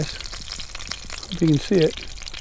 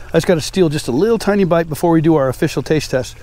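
ordinary delivery

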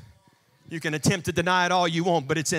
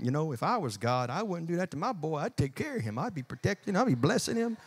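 under the breath; 7 dB quieter, faster than usual. Acted. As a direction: mutter a private gripe. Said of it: "under your breath, you're going".

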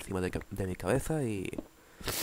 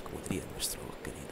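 whispered aside; 6 semitones lower, 9 dB quieter, much faster than usual. Real, not acted. Muttered aside.

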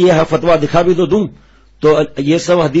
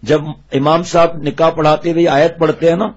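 ordinary talk

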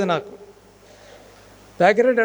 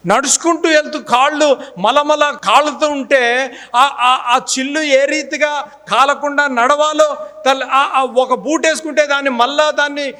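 raised, loud preaching voice; fervent testimony; 7 semitones higher, 4 dB louder than usual. Real, not acted. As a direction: preach it with rising fervour. Preacher raises voice recalling hardship. (ఉత్సాహంగా బోధించే స్వరం)

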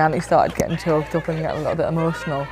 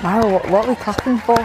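puzzled question; 5 semitones higher, 3 dB louder than usual. Retold or imitated. Retells puzzled question to teammate.

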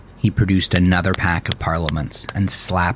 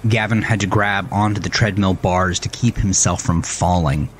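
usual speaking voice

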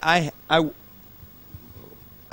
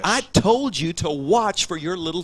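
higher and louder, scolding at full tilt; furious scolding; 7 semitones higher, 6 dB louder, faster than usual. Retold or imitated. Pastor father shouting at son who hit his sister. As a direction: let the father's rage boil over. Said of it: "He began to yell at his son".

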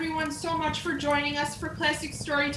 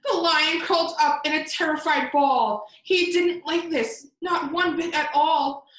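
louder, excited read-aloud; lively narration; 3 semitones higher, 5 dB louder than usual. A narrator's reading. Reading the surprise to children, lively and louder. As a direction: play up the funny twist.

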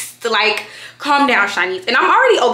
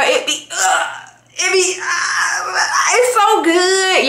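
mock crying; 8 semitones higher, much slower than usual. Real, not acted. Overwhelmed by how good food is.

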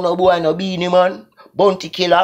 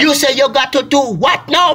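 normal talking voice

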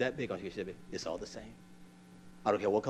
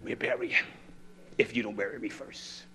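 ordinary talk, nothing marked

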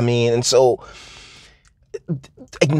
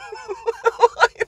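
whooping cheer. Retold or imitated. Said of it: "the control room goes".

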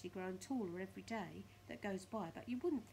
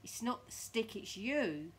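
Mr Twit gloating; 2 semitones higher, 7 dB louder, slower than usual. Acted. Mr Twit gloats at his wife.

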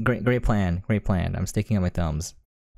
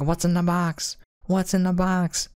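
higher, mock-frantic repeated question; mock desperate hero; 8 semitones higher than usual. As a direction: ham up a panicked Se7en-style plea. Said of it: "And then he's like".

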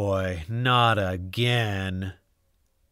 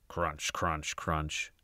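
much softer, lower crunch; hushed crunching; 7 semitones lower, 10 dB quieter, much slower than usual. A narrator's reading. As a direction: whisper the dreaded munching. Dragons eating spicy tacos.

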